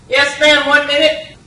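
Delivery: crisp sergeant. Retold or imitated. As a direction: bark it out like a drill-sharp recruiter. Sergeant voiced in story.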